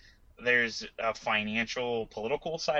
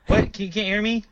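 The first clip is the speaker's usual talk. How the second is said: exasperated, pitched up; irritated snapping; 8 semitones higher, 6 dB louder than usual. Real, not acted. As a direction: snap it out in frustration. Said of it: "This is so frustrating".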